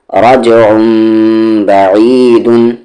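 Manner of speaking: रज उम with a bouncing echo on the ज; bouncing qalqala. Shown as a demonstration. Teacher demonstrating qalqala echo, slow. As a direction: make the ज of रज bounce. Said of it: "कलकला हो रहा है, यानी कि बाउंसिंग साउंड".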